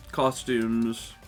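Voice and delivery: ordinary talk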